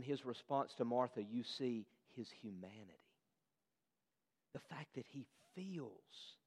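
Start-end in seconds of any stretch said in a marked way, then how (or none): 4.5-6.4 tender. Real, not acted. Jesus' humanity, tender point.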